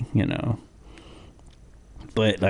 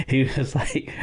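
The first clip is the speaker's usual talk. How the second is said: ordinary talk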